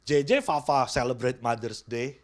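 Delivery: usual speaking voice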